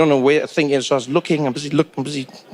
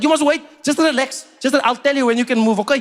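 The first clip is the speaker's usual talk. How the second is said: much higher, patient explaining; patient seatmate; 6 semitones higher than usual. Retold or imitated. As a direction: seatmate explaining the phone's sound delay, higher voice. Seatmate instructing.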